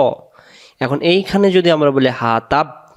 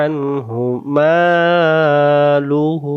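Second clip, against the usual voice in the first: very slow chanted recitation; Quran recitation; much slower than usual. Shown as a demonstration. Teacher repeats the phrase, chanted very slowly. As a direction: draw out মালুহু long and nasal. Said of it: "আবার".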